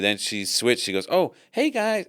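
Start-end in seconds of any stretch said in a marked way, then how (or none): 1.1-2.0 put-on high, bright American accent greeting; chirpy American accent, voice jumps up. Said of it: "she had the American accent".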